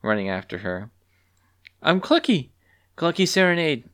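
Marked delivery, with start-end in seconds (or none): 1.8-3.9 high, loud, eager introduction; high-pitched eager call. A narrator's reading. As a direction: call your name out eagerly. Calling after Talon while running.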